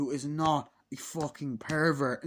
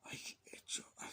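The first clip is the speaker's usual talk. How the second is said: whisper; 15 dB quieter than usual. Real, not acted. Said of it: "trails off".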